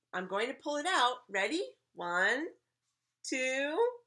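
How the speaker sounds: put-on high, loud kid showman; eager counting with pauses; suspenseful trick countdown. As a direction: build up to the big moment. Child announces next trick.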